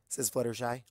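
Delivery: ordinary delivery, nothing marked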